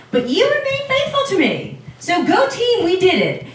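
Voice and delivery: high-pitched, chummy cheer; playfully acted elated high-five voice; mock celebration. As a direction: hype up your teammate, hammed up. Imagined God cheering Job on.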